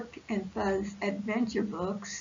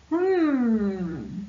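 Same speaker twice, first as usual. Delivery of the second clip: thoughtful hum; 5 dB louder than usual. Acted. Buzz thinking.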